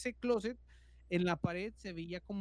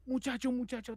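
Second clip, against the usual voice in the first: higher, insistent repeated call; desperate calling; 6 semitones higher than usual. Retold or imitated. Trapped man calling the boy from the closet, desperately. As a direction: keep calling, pressed and urgent. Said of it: "Muy desesperado".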